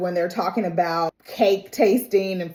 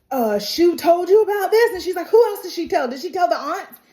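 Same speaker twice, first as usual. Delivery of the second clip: put-on much higher, louder startled girl; alarmed Daisy; 9 semitones higher, 3 dB louder than usual. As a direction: imitate a panicked friend. Daisy alarmed, voiced in retelling.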